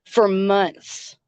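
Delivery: ordinary talk, nothing marked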